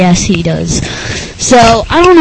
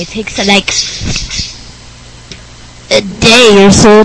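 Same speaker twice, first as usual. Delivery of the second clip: goofy nerd character, rambling; Rick Berner. Acted. Nerd guest recounts his Japan trip, goofy voice.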